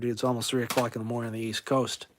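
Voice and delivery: usual speaking voice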